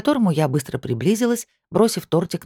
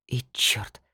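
breathless whispered curse; 3 semitones lower, 6 dB quieter than usual. Real, not acted. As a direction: curse under your breath.

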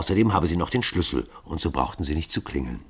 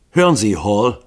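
louder, irritated call across the room; 3 semitones higher, 8 dB louder than usual. A narrator's reading. Harkins calling out irritably to Hall as he intrudes. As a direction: snap his name out in annoyance. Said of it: "rief Harkins offensichtlich gereizt".

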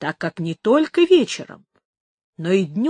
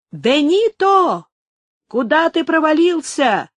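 loud, high call across the yard; shouting; 8 semitones higher, 5 dB louder, slower than usual. A narrator's reading. Workers calling Benito from across the yard, shouting. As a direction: yell for the missing worker. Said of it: "кричали прочие работники".

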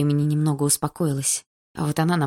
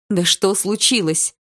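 exasperated mother; 4 semitones higher, 4 dB louder, slower than usual. A narrator's reading. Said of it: "не выдержала мама".

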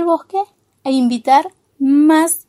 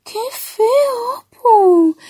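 high, whiny storybook voice; higher-pitched, offended whine; hurt reproach; 7 semitones higher, much slower than usual. A narrator's reading. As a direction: wail a wounded complaint. Scolded bug reproaches Opu.

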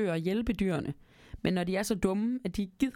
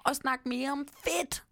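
high, anxious begging; pleading promise; 9 semitones higher than usual. A narrator's reading. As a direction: promise anything to get free. Trapped mouse promising.

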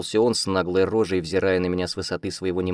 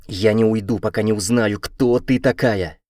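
loud, firm, slow at the end; determined; 3 semitones higher, 6 dB louder than usual. A narrator's reading. Royce refuses to leave the heroine: loud determined. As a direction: say it loud and unyielding. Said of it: "решительно заявил он".

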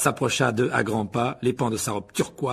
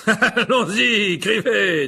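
slow, laughing jovial order; chuckle, then call out grandly with a grin; big smile; 10 semitones higher, 8 dB louder, much slower than usual. Acted. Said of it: "dit Lockhart avec un grand sourire". (invitation rieuse, sourire dans la voix)

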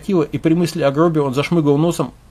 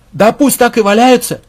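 loud, high, careless shout; much higher and louder cutting in; brash interjection; 9 semitones higher, 9 dB louder than usual. Acted. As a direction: butt in loudly, not caring. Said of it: "вновь влез Хронов".

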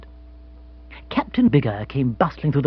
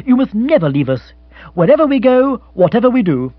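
high, loud, heartfelt insistence; impassioned young gentleman; earnest appeal; 10 semitones higher, 7 dB louder than usual. Acted. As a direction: implore him with fervor. Bill implores Jeeves to stay.

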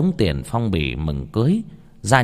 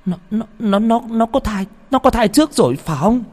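much higher, stuttering in disbelief; shocked stammer; 12 semitones higher than usual. Acted. Mother realising the bride was already pregnant, shocked stammer. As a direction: stammer as the truth dawns. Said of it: "Mụ lắc đầu không tin".

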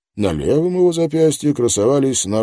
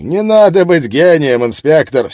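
brash reporter; 8 semitones higher, 9 dB louder than usual. Acted. Miller mocking loudly.

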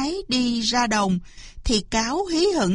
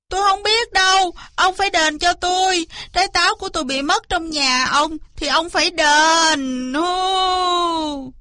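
high, loud howling demand; bawling, insistent tantrum; wailing tantrum; 7 semitones higher, 3 dB louder than usual. Acted. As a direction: throw a hammed-up fit, ending in a long wail. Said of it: "Cáo liền lăn ra đất tru tréo lên".

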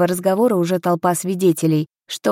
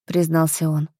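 ordinary delivery, nothing marked

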